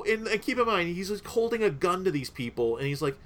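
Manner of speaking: ordinary talk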